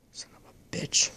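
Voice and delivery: breathless under-the-breath swear; whispered curse. Real, not acted. Annoyed at the phone, whispering a curse. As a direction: hiss it quietly to yourself.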